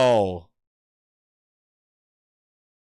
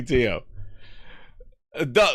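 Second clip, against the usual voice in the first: usual speaking voice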